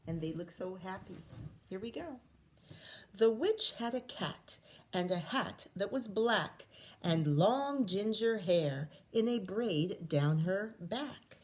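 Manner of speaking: ordinary delivery, nothing marked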